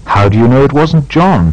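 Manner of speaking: "Why didn't you come last week?" rising steadily, short fall on "week"; a frank, polite question that climbs and dips at the end; "Why didn't you come last week?" with a continuous rise and a final fall; rising tune, short final fall. Shown as a demonstration. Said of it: "Make similar questions using why or how".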